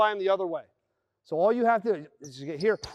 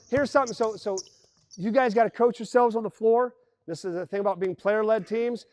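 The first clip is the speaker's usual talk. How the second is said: ordinary delivery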